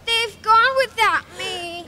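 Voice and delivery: high, loud wail of a sad little dinosaur; crying. Acted. Drip left behind by his sisters, hammed-up wailing. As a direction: bawl it out, hammed-up. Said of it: "And he cried".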